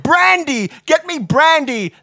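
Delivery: high, loud, drawn-out cries; urgent demand. A narrator's reading. Collapsed Watson demands brandy instead, loud and melodramatic. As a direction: call out desperately for a drink.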